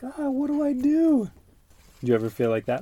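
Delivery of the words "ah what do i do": high, pleading cry to the sky; despairing cry. Retold or imitated. Father acts Jameson crying out to the sky, hammed-up despair. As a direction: wail it up at the heavens in mock despair. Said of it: "looking up at the sky like".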